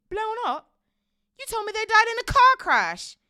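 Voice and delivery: high, loud disbelief; shocked outburst. A narrator's reading. Harry hearing how his parents died, shocked. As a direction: cry out as the lie hits you. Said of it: "As soon as he found his voice".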